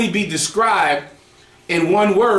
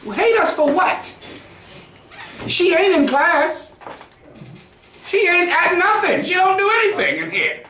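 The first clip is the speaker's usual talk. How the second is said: much higher, heated, near-shouting disbelief; exasperated rant; 9 semitones higher than usual. Retold or imitated. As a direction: fire off the questions, laughing in outrage. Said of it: "then I go to ask the brother".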